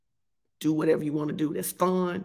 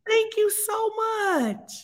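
touched thanks; 13 semitones higher, much slower than usual. Real, not acted. Touched thanks, then repeated.

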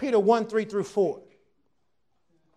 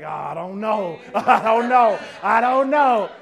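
mock unsure guest; 3 semitones lower, 8 dB louder than usual. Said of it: "when they start hollering real loud".

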